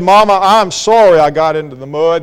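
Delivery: ordinary talk, nothing marked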